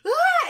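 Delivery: sudden high, loud startled scream; mock freaked-out yell. Boyfriend seeing her peeling skin, mock startled scream. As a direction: yelp as if horrified, hammed-up. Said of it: "freaks out at the sight".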